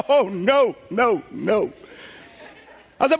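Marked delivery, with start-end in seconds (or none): 0.0-1.7 laughing refusal. Retold or imitated.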